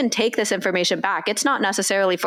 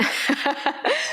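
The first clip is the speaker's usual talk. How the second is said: high, light laugh; real giggle at your own joke; 3 dB quieter than usual. Real, not acted. Joking about pressing the button at the right moment, laughing.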